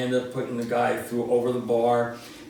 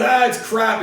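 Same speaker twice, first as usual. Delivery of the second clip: scoffing dad; 7 semitones higher, 5 dB louder than usual. Retold or imitated. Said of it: "he goes".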